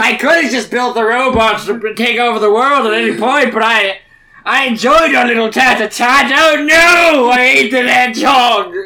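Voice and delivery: high, loud sobbing speech; blubbering eulogy. Acted. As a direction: weep through a mock eulogy. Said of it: "He's just like".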